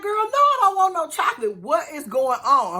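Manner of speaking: high, loud impatient demand; exasperated friend. Retold or imitated. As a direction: cut through the nonsense, loud and high. Friend bursting in demanding answers.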